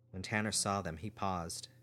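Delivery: usual speaking voice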